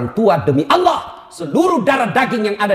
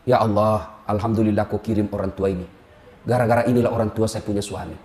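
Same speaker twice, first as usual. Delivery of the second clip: low, soft, pious thanks; mock grateful prayer; 12 semitones lower, 4 dB quieter than usual. Wife thanks God for her in-laws, softly. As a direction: pray quietly in gratitude.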